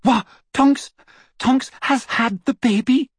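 very high, astonished shriek. A narrator's reading. Hermione reacting to Tonks's baby, shrieking. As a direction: squeal the question in disbelief. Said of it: "Hermione shrieked".

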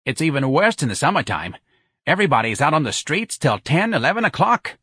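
brassy New Orleans local; chatty Santa. Acted. Santa grumbles about the noisy street, lively.

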